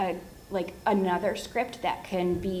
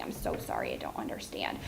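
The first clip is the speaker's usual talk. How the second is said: much lower and softer, apologetic; gentle apology to child; 9 dB quieter than usual. Shown as a demonstration. Therapist gently telling a child she doesn't understand, much lower and softer. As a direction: let the child down gently, low and soft. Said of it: "you're just trying to say".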